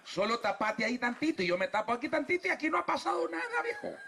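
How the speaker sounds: high, quick coaxing wife voice; Eva whispering a quick cover-up to her husband; coaxing Eve. Retold or imitated. (voz de Eva alta y rápida, engatusando)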